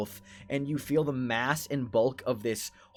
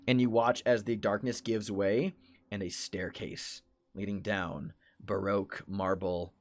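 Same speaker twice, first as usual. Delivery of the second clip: usual speaking voice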